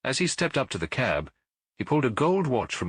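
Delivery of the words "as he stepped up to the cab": ordinary delivery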